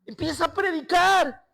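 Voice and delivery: high, loud preaching at full force; fervent preaching. Acted. Pastor preaching to the church at the climax, full force. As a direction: build the congregation up with fire.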